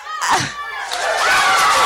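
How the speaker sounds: high unvoiced shout of laughter; a high delighted laugh-cry; joyful shriek-laugh. Real, not acted. Overwhelmed joy in testimony.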